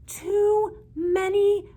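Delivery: very high, loud, slow wail. A narrator's reading. Old woman crying about too many fairies. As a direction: wail it out in despair.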